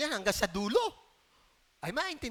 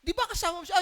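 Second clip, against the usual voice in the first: accusing bystander; 6 semitones higher, faster than usual. Retold or imitated. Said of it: "Sabi ng mga tao".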